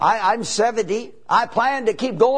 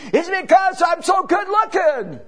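mock boast; 9 semitones higher, 3 dB louder than usual. Real, not acted. Joking rhetorical question to congregation.

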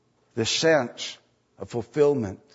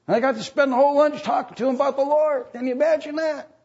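rising, breathless eager chatter; excited churchgoer; 11 semitones higher than usual. Acted. Churchgoer telling a friend, eagerly excited. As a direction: gush like you can't wait to share the news.

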